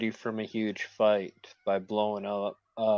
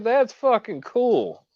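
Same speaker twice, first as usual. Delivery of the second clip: high, slow, drawn-out praise; excited; 13 semitones higher, 8 dB louder, slower than usual. Real, not acted. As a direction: gush with delight.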